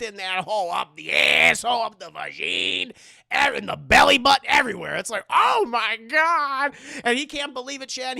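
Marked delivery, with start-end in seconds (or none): 5.3-6.7 loud, slow, high mock gasp; imitated horror. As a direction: draw it out in comic shock. Imitates horrified father.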